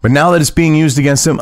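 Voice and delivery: ordinary talk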